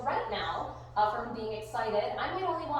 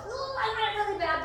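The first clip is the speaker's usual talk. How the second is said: high, put-on dog voice; high little squeal of wanting; impatient dog's voice; 10 semitones higher than usual. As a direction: voice the dog's craving, pitched up. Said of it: "So there he goes".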